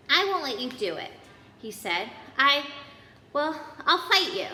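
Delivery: a hint of a shaky Neville standing firm; nervous defiance. A narrator's reading. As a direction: brave but scared boy. Neville confronts friends.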